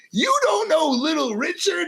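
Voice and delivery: high-pitched astonished question; incredulous. Retold or imitated. Mentor to rookie who doesn't know the singer, incredulous. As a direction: imitate a mentor's stunned disbelief. Acting Dusty's disbelief at him.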